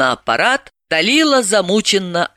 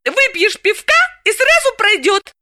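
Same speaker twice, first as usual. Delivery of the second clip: Galina teasing. Acted. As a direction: rib her friend mercilessly. Galina voiced.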